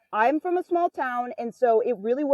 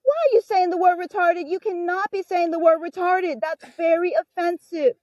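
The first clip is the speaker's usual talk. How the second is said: higher, preachy tattletale voice; mocking scolding girl; 8 semitones higher than usual. Mocking the annoying girl's preachy scolding. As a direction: mimic a self-righteous scold. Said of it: "and she was like".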